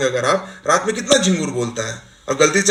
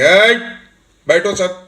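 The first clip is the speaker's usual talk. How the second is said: higher, louder teacher's call to order; one loud command; 4 semitones higher, 4 dB louder, much slower than usual. Acted. Ma'am ordering a noisy class to sit, loud. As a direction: call out once, sharply raised, to silence everyone. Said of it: "एक बार जोर से बोलेंगे".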